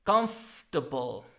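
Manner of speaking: COMFORTABLE said "KUMF-tuh-bul"; COMFORTABLE with a weak "tuh-bul" ending; reduced ending on COMFORTABLE. Shown as a demonstration. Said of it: "The right pronunciation would be".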